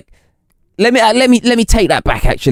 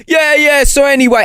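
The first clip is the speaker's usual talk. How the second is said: high, chirpy mimicked phone chat; mock phone chatter; 11 semitones higher than usual. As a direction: imitate a chatty commuter on the phone.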